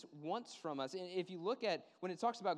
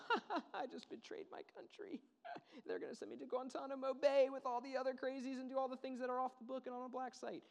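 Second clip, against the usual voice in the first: much higher, laughing through the words; mock laughing traitor; 10 semitones higher than usual. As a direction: cackle like someone proud of betraying his country.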